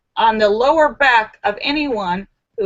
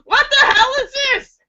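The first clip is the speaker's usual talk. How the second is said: much higher, hammed-up indignant yelp; mock outrage. Receiver yells at a bad gift, high and hammed-up. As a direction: play the insulted gift receiver. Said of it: "you involuntarily shout out".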